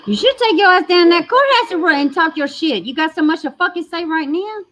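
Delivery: high, loud furious yell at the screen; yelling at the mother on the livestream, furious; angry shout. Real, not acted. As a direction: let her have it, full volume.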